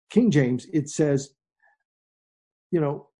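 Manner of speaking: ordinary delivery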